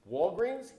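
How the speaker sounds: usual speaking voice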